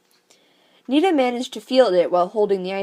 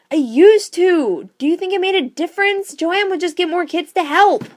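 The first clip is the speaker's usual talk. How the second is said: high, loud outburst at her dad; yelling back; 10 semitones higher, 6 dB louder than usual. Acted. Nita yelling at her father, frustrated and loud. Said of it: "I didn't mean to yell at you".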